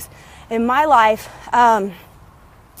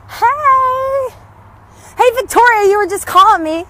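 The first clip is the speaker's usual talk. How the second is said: much higher, louder shout of hello; excited call-out; 9 semitones higher, 5 dB louder than usual. Real, not acted. Vlogger shouts to friend Victoria who just phoned, loud happy call. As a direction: call across to a friend happily.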